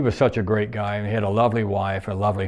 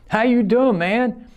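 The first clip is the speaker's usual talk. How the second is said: excited greeting; 13 semitones higher, 4 dB louder, slower than usual. Retold or imitated. Greeting old friend at restaurant.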